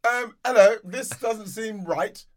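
high, slow mock-posh English. Posh person querying an envelope of cash, mock-posh voice. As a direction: put on a posh, drawn-out complaint. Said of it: "only posh people would".

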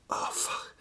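whispered disbelief, very soft; stunned whisper. Acted. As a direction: breathe it out under your breath. Acted stunned reaction to absurd claim.

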